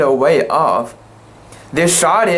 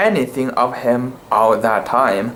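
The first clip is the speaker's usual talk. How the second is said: ordinary delivery, nothing marked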